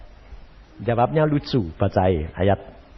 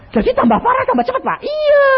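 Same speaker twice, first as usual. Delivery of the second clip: high, loud alarm; patient alarmed at pastor, high; shocked patient; 4 dB louder, faster than usual. Retold or imitated. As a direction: gasp at the bad news. Pastor-patient dialogue voiced.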